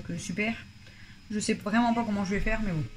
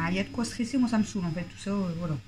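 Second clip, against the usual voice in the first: ordinary delivery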